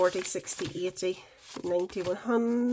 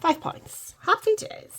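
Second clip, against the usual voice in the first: pleased; 12 semitones higher, 5 dB louder than usual. Real, not acted. Pleased to find five pounds.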